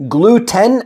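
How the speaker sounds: Gluten as glue-TEN, pitched high and rising; over-full second syllable. Shown as a demonstration. Teacher showing what to avoid, Gluten with full "ten". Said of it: "I don't say gluten".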